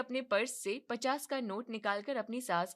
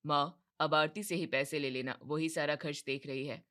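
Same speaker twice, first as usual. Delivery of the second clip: a hint of a tired son, lower; son's voice; 7 semitones lower than usual. Son telling mother to ask his wife, lower and tired. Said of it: "तब राजेश कहता है".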